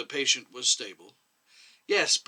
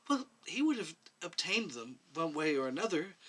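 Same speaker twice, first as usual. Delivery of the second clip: barely audible, much softer; scarcely audible; 7 dB quieter than usual. A narrator's reading. Medic answering the commission, barely audible. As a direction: say it almost under her breath. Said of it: "in a scarcely audible voice".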